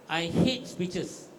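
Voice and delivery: drawn-out, deliberate; slow emphasis. Real, not acted. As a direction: let each word land slowly. Speaker abandons prepared speech.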